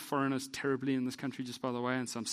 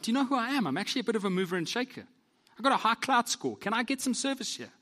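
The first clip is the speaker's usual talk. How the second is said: much higher, fast, self-important demand; entitled demand; 9 semitones higher, 3 dB louder than usual. Pretend. Pastor voices his proud heart at the counter, entitled huffy demand. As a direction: puff yourself up like a VIP who expects service. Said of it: "parts of me that wanna go like".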